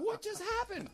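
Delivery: high-pitched stunned question; mock bewilderment. Man watching neighbors come out, high mock bewilderment. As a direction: squeak it out in comic disbelief.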